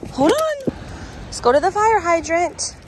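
high sing-song pet voice; talking to a dog. Real, not acted.

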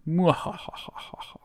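breathy villain cackle, slow; a slow whispered cackle; whispered evil laugh. Acted. Villain laughs after threat.

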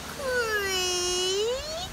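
high, long drawn-out door creak. A narrator's reading. Storyteller opening the little wooden door, creaking. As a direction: creak like an old door swinging open.

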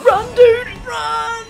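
high panicked scream; screaming in real panic. Real, not acted. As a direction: shriek at him to run. Panicked urging to flee.

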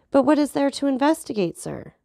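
lightly voiced outrage, demanding; enraged. A narrator's reading. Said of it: "enraged beyond measure".

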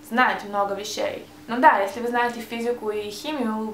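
usual speaking voice

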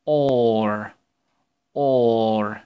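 or said as a long, clear or; model the or sound. Shown as a demonstration. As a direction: or held out for children to repeat. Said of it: "listen and repeat".

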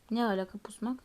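ordinary talk

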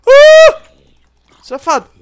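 sudden loud fright scream; startled scream. Real, not acted. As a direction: shriek from a jump scare.

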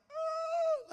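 high loud cry of panic; retold freak-out scream. Preacher retelling his courtroom freak-out, high panicked yelp. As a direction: let out a high mock panicked "ah". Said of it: "like, I'm freaking out".

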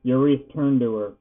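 ordinary delivery, nothing marked

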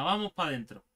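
ordinary talk, nothing marked

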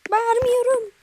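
a loud joking yell; ironic shout. Pretend. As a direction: shout Bağırmıyorum high and loud while denying shouting. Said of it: "çok bağırmıyorum".

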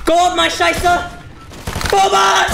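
excited shout. Real, not acted. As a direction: yell it at the teammate. Teammate shouting mid-fight.

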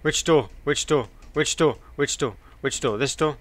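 frantic, loud and rushed; panicked. Real, not acted. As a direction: scramble for an exit in panic. Being chased.

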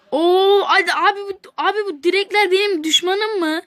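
exasperated outcry. Real, not acted. Frustrated at hitting the post.

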